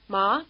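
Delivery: Ma with a rising question tune; questioning rise. Shown as a demonstration. Teacher likens the tone to a question, rising. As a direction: lift Ma at the end as if asking. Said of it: "Think of the question".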